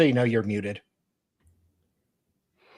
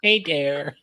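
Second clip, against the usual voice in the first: put-on Wisconsin accent; 6 semitones higher than usual. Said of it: "You got the tube".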